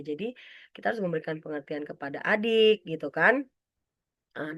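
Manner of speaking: normal talking voice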